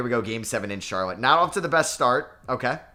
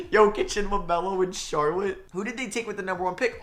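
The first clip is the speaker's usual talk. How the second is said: higher, bubbling delight; giddy excitement; 7 semitones higher than usual. Real, not acted. Gamer celebrating Jokic and LaMelo together, giddy excitement. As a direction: rave about the new duo.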